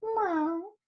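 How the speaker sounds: soft, rising cat meow. Acted. Cat meows softly to her tired friends. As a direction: meow quietly.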